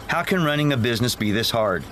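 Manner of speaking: ordinary talk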